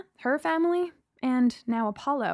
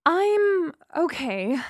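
slow, tight, holding back tears; understated choked-up reply; 5 semitones higher, 4 dB louder, much slower than usual. A narrator's reading. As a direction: force it out through a tight throat. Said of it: "my throat had constricted".

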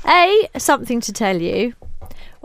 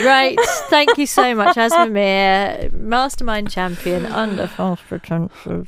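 ordinary talk, nothing marked

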